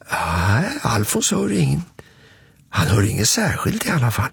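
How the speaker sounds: ordinary delivery, nothing marked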